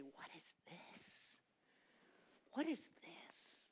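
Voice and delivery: breathless hushed wonder; slow amazed whisper; awed whisper. Retold or imitated. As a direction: whisper it slowly in awe.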